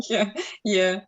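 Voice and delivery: ordinary talk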